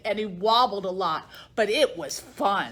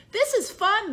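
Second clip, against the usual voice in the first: happy cheer; 10 semitones higher than usual. Acted. All the animals cheer.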